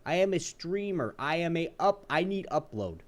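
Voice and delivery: high, stressed AM; emphatic repeating. Retold or imitated. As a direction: hammer each word to the rep. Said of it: "quoting himself to rep".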